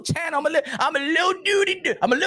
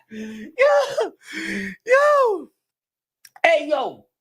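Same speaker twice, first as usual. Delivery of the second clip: ordinary talk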